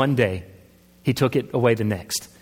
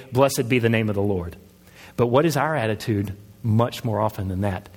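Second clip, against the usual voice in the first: ordinary delivery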